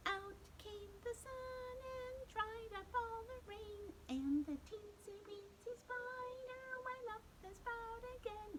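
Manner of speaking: very high squeaky singing voice; tiny squeaky spider singing; really high squeaky voice. Shown as a demonstration. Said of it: "we're gonna get a really high squeaky voice".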